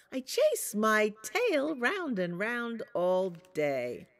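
Little Wolf. Acted. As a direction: bounce it like a happy pup. Little Wolf sings his chant.

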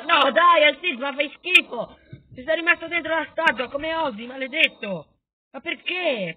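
loud, high gamer tantrum; outraged ranting. Pretend. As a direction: wail and curse at hero and enemies. Rages as statue is destroyed.

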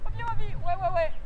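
high, cheerful coaxing call; sweet talking to a dog. Shown as a demonstration. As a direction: coax the dog back gently. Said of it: "lui parler le plus gentiment possible". (voix douce et encourageante pour le chien)

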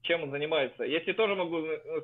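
ordinary delivery

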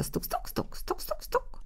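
very soft, high taps; hushed, clicky bird-peck noises; pecking sounds. A narrator's reading. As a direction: imitate tiny beaks pecking away. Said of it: "a za nimi pozostałe ptaszęta".

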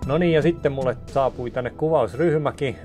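normal talking voice

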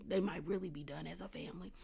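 hushed, dramatic whisper; secretive whispered aside. Real, not acted. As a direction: drop to a breathless whisper for the reveal. Dramatic aside about the family.

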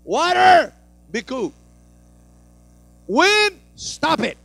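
loud, high command to the waves; commanding the storm. Retold or imitated. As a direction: order the wind and water to stop.

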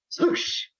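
unvoiced-breath whoosh; whooshing splash noise. Narrator imitates what brother hears behind him, whooshing noise. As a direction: imitate a sudden rushing splash.

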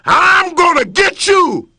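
loud, high threatening yell; lion threatening his reflection, yelling. Acted. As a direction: bark the threat. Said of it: "he yelled at it".

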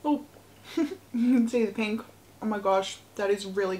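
chewing while talking; eating a candy bar; mouth full of chocolate. Real, not acted. Seen 0: Bites bar at -3; puffed cheeks, closed-lip chewing until about 3.7.

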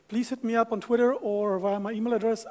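ordinary delivery

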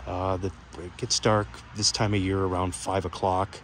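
ordinary delivery

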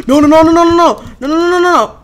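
frantic rising no, no, no; panicked high no's. Real, not acted. Panic, knocked back.

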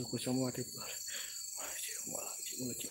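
very slow, hushed whisper; whispered prayer. Real, not acted. Host finishes protective verse after a scare, whispering slowly. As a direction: let the recitation sink into a whisper. Praying under the breath.

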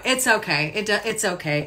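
soft mock-calm murmur; muttered self-reassurance. Walking round irritated, telling herself quietly it's okay. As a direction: brush it off under your breath again. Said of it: "I'm walking around going".

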